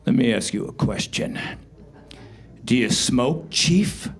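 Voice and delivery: a hint of a menacing movie interrogator; Jack Nicholson. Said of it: "a dead ringer for Jack Nicholson".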